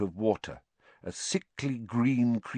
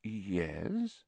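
soft, drawn-out, wary yes; slowly; 9 dB quieter than usual. A narrator's reading. Teacher cautiously answering Harry, slow. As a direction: stretch it out, waiting for more. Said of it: "said Lupin slowly".

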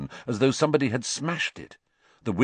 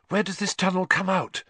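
breathless; 10 semitones higher than usual. A narrator's reading. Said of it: "Hermione asked breathlessly".

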